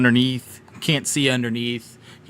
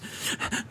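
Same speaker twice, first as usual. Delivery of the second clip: soft frightened gasp; 9 dB quieter than usual. Acted. Frightened voices behind door.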